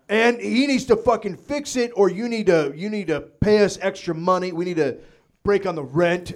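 loud, high mock-furious landlord rant; angry letter voice. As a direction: rant it like the nasty letter-writer.

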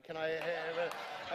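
usual speaking voice

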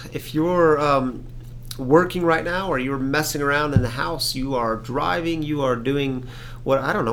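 normal talking voice

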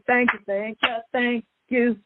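ordinary delivery

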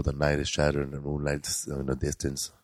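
low, soft poetry reading; hushed poem recital. Retold or imitated. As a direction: read it like a romantic narrator on tape. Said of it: "Andy Garcia does a narration".

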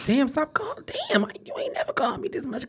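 soft, voiceless aside; whispered complaint. Real, not acted. Host to a caller phoning mid-show, whispered complaint. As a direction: whisper it under your breath, exasperated. Aside about someone who keeps calling.

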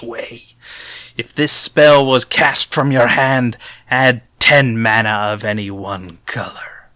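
slow, dramatic storybook reading; storybook narrator. Pretend. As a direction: read it like a grand fairy-tale narrator. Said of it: "in a storybook voice".

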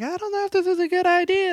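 very high mock-female voice; imitated worried woman. Chick doubting the break-in, high imitated voice. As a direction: squeak it out as a nervous girl. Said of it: "the chick's like".

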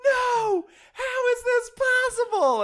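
very high, overdramatic wail; hammed-up horrified disbelief; over-the-top performance. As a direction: overact it like a bad first take. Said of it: "Bring it way down".

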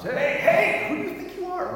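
high, loud indignant protest; indignant disciples. Retold or imitated. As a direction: play the offended disciples. Disciples voiced angrily.

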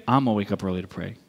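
low, quick copycat answer; copycat resolve. Retold or imitated. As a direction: drop the pitch and rattle it off. Copying friend, answering line.

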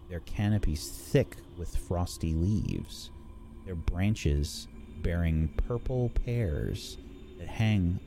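slow, hushed storyteller read; scene narration. A narrator's reading. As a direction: paint the scene slowly.

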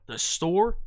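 "The store" with a rising ask; questioning "The store?". Shown as a demonstration. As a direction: repeat it as a question. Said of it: "Just repeat yourself".

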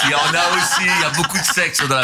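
laughing. Real, not acted. Laughing at sex joke.

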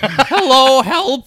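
high, loud squeaky Winnie call; hammed-up high-pitched plea; muffled cry for help. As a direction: cry out high and small, stuck.

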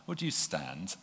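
ordinary talk, nothing marked

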